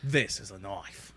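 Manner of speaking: low, slow mock Australian accent; Crocodile Dundee. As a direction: go deep and quiet in a put-on Aussie drawl. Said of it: "we just had Crocodile Dundee come in".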